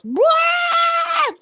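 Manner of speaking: very high, loud drawn-out roar; piercingly high mock crowd shout; imitated crowd screaming. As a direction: mimic an audience erupting in screams. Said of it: "Starts screaming".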